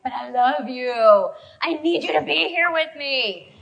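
slow, drawn-out lovesick plea; mock-clingy, stretch it out. Wife pleading with Dan, slow mock-clingy. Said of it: "And I'm like".

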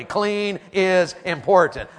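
slow, loud, every word leaned on; slow call to repeat. Shown as a demonstration. Preacher leading the congregation in a slow, loud chant. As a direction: lead the crowd, slow and loud. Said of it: "Say it with me".

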